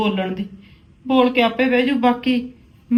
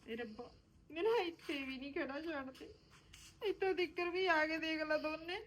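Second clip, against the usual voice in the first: very soft, crying plea to God; tearful lament; 2 semitones higher, 20 dB quieter than usual. Acted. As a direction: weep it out, barely audible. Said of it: "ਤੂੰ ਰੋ ਨਾ".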